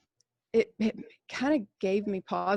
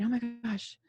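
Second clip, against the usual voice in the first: panicked whisper; 2 semitones higher, 6 dB quieter than usual. Retold or imitated. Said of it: "I just remember thinking".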